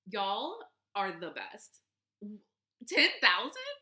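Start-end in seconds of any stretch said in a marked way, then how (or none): 2.9-3.8 louder and much higher, laughing in real disbelief; excited disbelief. Real, not acted. Host thanking listeners for ten thousand downloads, laughing excitedly. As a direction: squeal it out, overwhelmed with joy.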